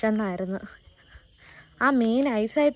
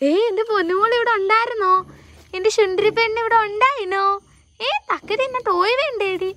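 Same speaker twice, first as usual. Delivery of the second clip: much higher, sing-song cooing to a dog; 10 semitones higher, 4 dB louder than usual. Real, not acted. (വളർത്തുനായയോട് കൊഞ്ചിയുള്ള സംസാരം)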